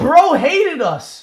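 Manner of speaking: mock wail. Mock anguish over developer.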